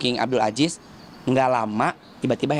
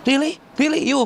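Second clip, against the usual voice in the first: disbelief; 12 semitones higher than usual. Retold or imitated. Man at the airport doubting she is famous, high incredulous. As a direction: act out a stranger who can't believe it. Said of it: "dia langsung gini".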